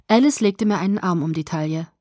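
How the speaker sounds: ordinary delivery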